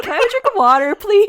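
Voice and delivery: high, loud mock-sweet plea; hammed-up sweet request. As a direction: beg sweetly, hamming up Audrey. Audrey voiced asking for water.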